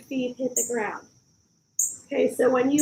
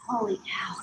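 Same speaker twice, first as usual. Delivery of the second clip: breathless whisper; 3 semitones lower, 7 dB quieter than usual. Real, not acted. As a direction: whisper it, worn out. Exhausted after the workout.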